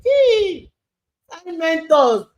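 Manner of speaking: mentor's breezy parting words, called out high; playful call. Retold or imitated. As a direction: toss it off brightly over your shoulder.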